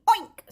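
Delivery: high, loud oink; pig oink. A narrator's reading. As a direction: imitate a pig with one oink. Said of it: "said the hog".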